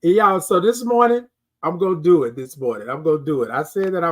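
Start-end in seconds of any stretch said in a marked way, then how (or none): none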